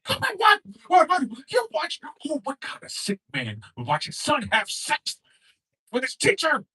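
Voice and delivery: scandalized and disgusted; wife catching husband watching video; shocked wife. Acted. Acted wife catching him.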